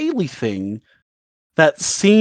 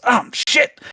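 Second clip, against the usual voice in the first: startled curse; 10 semitones higher than usual. Retold or imitated. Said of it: "one of the guards goes".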